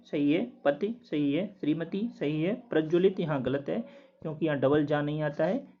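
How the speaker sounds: ordinary delivery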